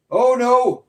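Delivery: high, loud hammed-up dismay; mock alarm. Streamer mock-panicking at a chat message, hammed-up alarm. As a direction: play it as pretend panic.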